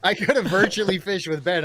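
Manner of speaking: loud, high, talking through a laugh. Real, not acted. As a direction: answer the tease while cracking up. Laughs at co-host's teasing question.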